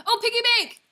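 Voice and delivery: high, loud eureka shout; excited shout. Real, not acted. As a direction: shout the answer in triumph. Finally guessing the drawing.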